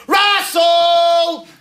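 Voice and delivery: high, drawn-out call to another room; yelled name. Retold or imitated. Missus calling her husband downstairs, long high yell. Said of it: "the missus will yell out".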